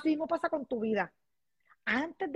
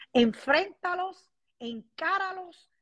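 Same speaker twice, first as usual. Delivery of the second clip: slow, higher, each word pressed; emphatic encouragement; 6 semitones higher, 4 dB louder, much slower than usual. Real, not acted. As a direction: push them to face it, word by word. Animando a vencer la tristeza. (lento y enfático, animando)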